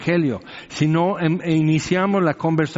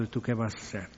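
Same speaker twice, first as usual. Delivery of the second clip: softer, menacing devil's taunt; quiet taunt; 4 semitones lower, 12 dB quieter, faster than usual. Retold or imitated. The devil quietly taunts the young missionary, soft mocking voice. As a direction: drop to a softer mocking challenge. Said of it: "el demonio diciendo".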